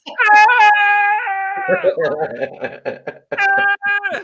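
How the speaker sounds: high loud bleating goat. Retold or imitated. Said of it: "You know how goats".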